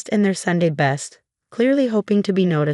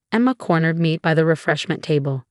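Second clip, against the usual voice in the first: plain reading voice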